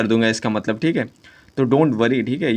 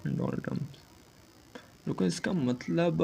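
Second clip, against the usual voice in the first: whispered to self; 5 semitones higher, 11 dB quieter than usual. Real, not acted. Trying to recall meaning.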